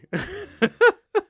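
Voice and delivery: bursts out laughing; a high, delighted laugh. Real, not acted. Teasing Chris about lying.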